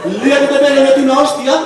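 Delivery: high, loud jeering wisecracker; taunt, laughing at him; mocking joker. The joker taunts the hairy guy, high, loud jeers. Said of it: "O, por ejemplo".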